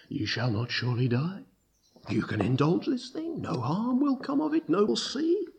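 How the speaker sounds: soft coaxing that climbs high; insinuating tempter. Acted. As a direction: lull them into it, quietly, pitch rising as you go. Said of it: "he comes again and he insinuates".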